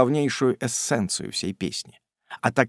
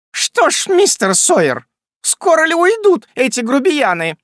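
very high, loud piercing screech; визг; 14 semitones higher, 7 dB louder than usual. Acted. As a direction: shriek down at the lodger to clear them out. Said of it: "завизжал опять пронзительный голос". (хозяйка пронзительно визжит с лестницы)

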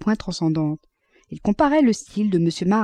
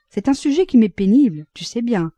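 plain reading voice

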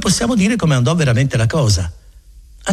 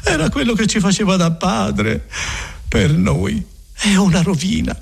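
despairing Agnese; 9 semitones higher than usual. Acted. Agnese telling the friar she is ruined, mildly tearful. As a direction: mourn the loss of her guide.

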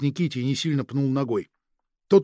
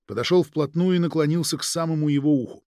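ordinary delivery, nothing marked

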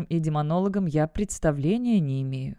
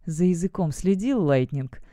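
ordinary delivery, nothing marked

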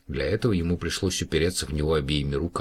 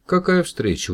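high, loud delighted gasp of a greeting; surprised girl, much higher and louder; girl surprised to meet the alien again; 13 semitones higher, 6 dB louder than usual. A narrator's reading. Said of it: "удивилась она".

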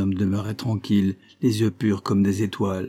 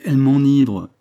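louder, rapturous cry; exclaiming; 4 semitones higher, 7 dB louder than usual. A narrator's reading. Cossack still exclaiming about her hair. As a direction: let the rapture burst out. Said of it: "s'écria le Cosaque".

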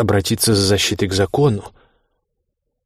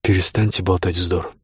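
ordinary delivery, nothing marked

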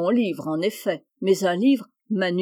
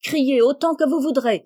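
high, loud, worked-up defiance; excited outburst; 5 semitones higher, 8 dB louder than usual. A narrator's reading. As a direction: fling it back in a temper. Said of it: "très excitée à son tour". (éclat excité, aigu et fort)

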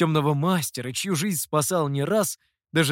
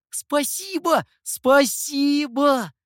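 much higher, excited thanks, the second slow and louder; overjoyed thanks; 11 semitones higher, 6 dB louder, much slower than usual. A narrator's reading. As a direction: gush your thanks. Scribe jumping up, bowing.

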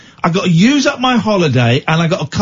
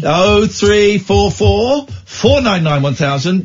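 slow number read; 4 semitones higher, much slower than usual. Acted. Reading phone number slowly.

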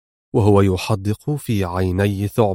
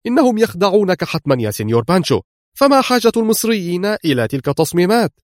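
higher-pitched urgent warning; acted outburst, pitched up; urgent exclamation; 7 semitones higher, faster than usual. A narrator's reading. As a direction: burst in with an excited warning. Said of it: "اندفع إفرايم يهتف".

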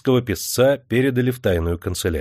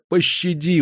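pleading; 8 semitones higher than usual. A narrator's reading. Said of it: "взмолилась она".

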